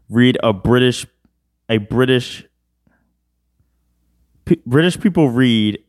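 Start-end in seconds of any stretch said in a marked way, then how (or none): none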